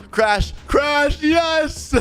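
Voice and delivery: high, triumphant yelling; excited shout. Real, not acted. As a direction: whoop it out as the crash lands. Rams suspect's car, triumph.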